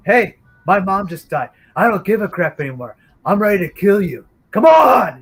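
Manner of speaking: high, loud reckless taunt ending in a shout; defiant challenge. Retold or imitated. Man squaring up to the wrench-wielding stranger, defiant shout. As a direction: dare him to come at you, nothing to lose.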